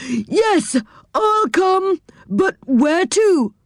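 high, slow, calling back from afar; shouted reply. A narrator's reading. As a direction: shout the answer, drawn out. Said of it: "shouted back".

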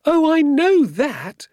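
very high, loud, drawn-out wail. A narrator's reading. Woman admitting her mistake to father, wailing. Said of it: "she wailed".

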